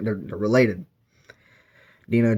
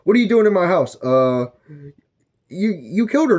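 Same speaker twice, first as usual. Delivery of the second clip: accusing husband; 7 semitones higher than usual. Retold or imitated. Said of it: "we'll use Pennywise at the duck form".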